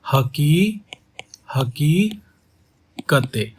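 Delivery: हकीकते drawn out very slowly, syllable by syllable; very slow pronunciation demo. Shown as a demonstration. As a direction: stretch हकीकते so every syllable is heard. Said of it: "pronounce करो, इसको उच्चारण करो".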